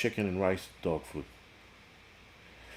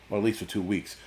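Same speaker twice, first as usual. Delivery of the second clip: ordinary talk, nothing marked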